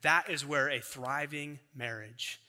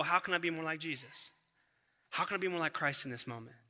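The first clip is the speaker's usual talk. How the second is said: quiet inner question; 7 dB quieter, faster than usual. Retold or imitated. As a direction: ask yourself quietly, almost prayerful. Prayerful self-question inside sermon.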